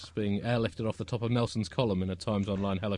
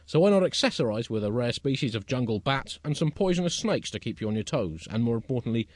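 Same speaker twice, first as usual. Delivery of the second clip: raised, emphatic magazine-show presentation; mock presenter; 5 semitones higher, 6 dB louder than usual. Presenter pitching indoor ecology to listeners, mock promotional delivery. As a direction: sell the tips to the audience.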